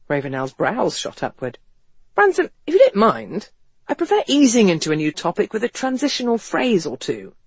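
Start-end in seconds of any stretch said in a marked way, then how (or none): 2.2-7.3 higher-pitched, mannered gentleman's voice; West, wryly taken aback. Acted. West reacting to Ethan asking for guns, wryly taken aback. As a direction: object politely, pitch lifted. West's startled dry objection.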